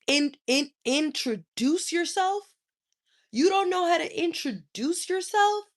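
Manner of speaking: rising high disbelief; mock their stumbling, amazed; incredulous. Real, not acted. Mocking stammer, disbelief.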